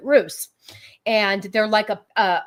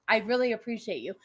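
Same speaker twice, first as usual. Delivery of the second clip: ordinary delivery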